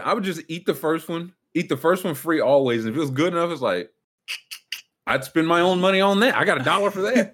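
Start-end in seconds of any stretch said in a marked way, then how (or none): 5.1-7.3 laughing through the line, voice up high. Real, not acted. Recalling school lunch with a co-host, laughing. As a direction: chuckle it out, amused. Laughing at his own story.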